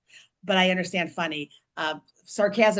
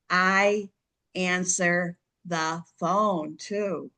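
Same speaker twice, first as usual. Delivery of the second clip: slow, word by word with pauses; deliberately slow; much slower than usual. Shown as a demonstration. Guest spelling out why calling is best, deliberately slow. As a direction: say it slowly, spacing out each word. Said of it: "I'm gonna say this slowly".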